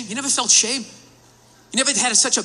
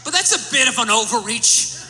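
ordinary delivery

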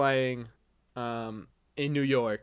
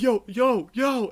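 higher, louder mock yell across the bar; retold yell; 8 semitones higher, 4 dB louder, slower than usual. Yelling yo at the hockey guys across the bar, mock shout. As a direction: call out to get their attention. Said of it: "who the fuck's yelling at".

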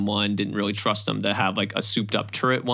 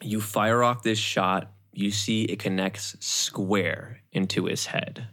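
ordinary delivery

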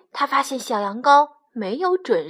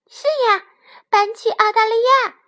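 high, quick, eager lamb voice; high-pitched delighted child sheep; excited little lamb; 11 semitones higher, 4 dB louder, faster than usual. A narrator's reading. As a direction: chirp up with sudden hope. Lamb welcomes moving to Australia.

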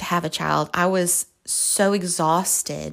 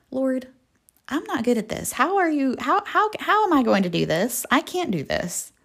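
higher-pitched, halting protest; exasperated pleading; 5 semitones higher than usual. Retold or imitated. Woman protesting to the Lord that she can't, exasperated pleading. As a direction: stammer out a mock-overwhelmed complaint.

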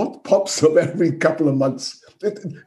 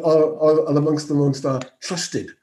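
ordinary talk, nothing marked

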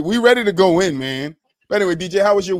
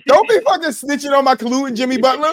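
playful fake outrage; mock angry; 7 semitones higher than usual. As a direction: pretend you're mad at him.

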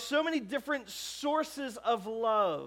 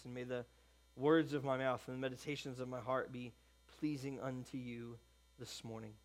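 hushed, lowered praying voice; earnest prayer; 5 semitones lower, 6 dB quieter than usual. Real, not acted. Pastor leading the congregation in prayer before preaching, hushed and reverent. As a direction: pray softly and humbly, pausing between petitions.

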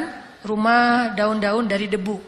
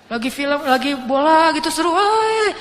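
building higher and louder into a whooped "wah"; excited viewer; 7 semitones higher, 7 dB louder, slower than usual. Acted. Preacher playing someone engrossed in a football match, mounting excitement. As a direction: get carried away by the match, rising to a cheer.

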